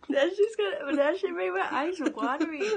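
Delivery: loud, laughing, eyes watering; laughing through the burn. Real, not acted. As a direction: laugh it out, spice burning. Spice makes eyes water.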